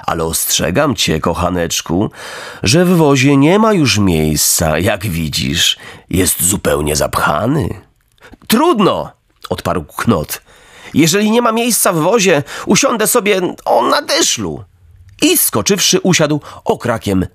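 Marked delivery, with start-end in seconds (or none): 0.1-7.7 low, sweetly wheedling coachman; lowered, honeyed voice of a fat coachman; fawning coachman. Acted. 8.5-9.1 put-on high, breezy boyish voice; lively raised boy's voice; cheeky boy Knot. 10.9-14.6 put-on high, breezy boyish voice; lively raised boy's voice; cheeky boy Knot.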